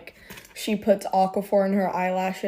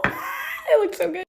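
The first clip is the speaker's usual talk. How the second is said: high squeal of delight, then gushing; 13 semitones higher than usual. Real, not acted. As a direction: squeal at the finished look. Admiring her lip combo.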